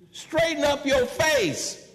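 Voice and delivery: loud, high, drawn-out scolding; parental order. Retold or imitated. Parent telling children off, loud high-pitched command. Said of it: "That's what you tell your children".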